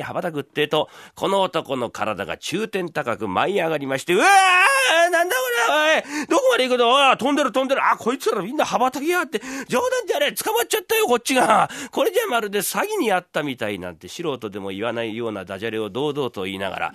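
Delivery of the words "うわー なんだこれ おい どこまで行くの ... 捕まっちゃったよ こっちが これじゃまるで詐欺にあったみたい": high, loud, frantic cries while flying off; panicked yelling. Acted. Man snatched up by herons, shouting high in panic. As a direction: scream in terror as the birds lift you.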